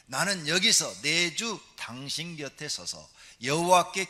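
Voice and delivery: slow, loud solemn recitation; solemn scripture reading. Acted. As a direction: declaim the verse with weight. Reads Hannah's words from scripture.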